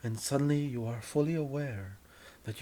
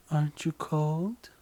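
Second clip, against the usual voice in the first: coy; 5 semitones higher, 5 dB louder than usual. Acted. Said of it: "in that coy way".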